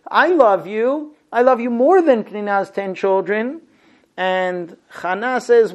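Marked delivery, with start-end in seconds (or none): 0.1-3.6 pleading husband. Retold or imitated. Husband begging his weeping wife to eat, raised pleading voice. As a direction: coax her to stop crying. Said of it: "Elkanah tries to placate his wife Hannah".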